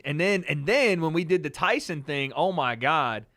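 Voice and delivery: usual speaking voice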